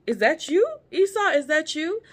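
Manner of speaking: high-pitched, searching question; questioning father. Retold or imitated. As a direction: call out uncertainly, voice lifting sharply. Father doubting which son is there.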